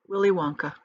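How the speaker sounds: plain reading voice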